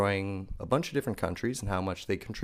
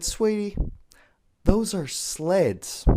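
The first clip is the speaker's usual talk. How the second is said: higher, very slow sugary put-down; patronizing teacher; 10 semitones higher, much slower than usual. Retold or imitated. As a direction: drag it out like explaining to a toddler. Teacher voiced condescendingly.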